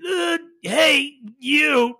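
high, strained squeezed-out words. Shown as a demonstration. Game master shows player crushed lungs, squeezed and strained. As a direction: force each word out with no air. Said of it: "a little bit of a strain to squeeze it out".

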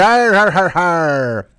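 hammed-up arr-har-har like a sea dog; pirate laugh. Host playing an Irish pirate, loud mock laugh. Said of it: "It's a pirate".

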